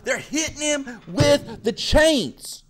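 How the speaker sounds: loud, high, slow yelling; excited yell. Real, not acted. Teacher hyping the class about the beating, loud high. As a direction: shout it out with energy.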